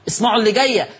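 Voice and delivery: normal talking voice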